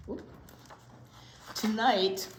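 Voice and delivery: normal talking voice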